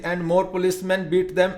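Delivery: usual speaking voice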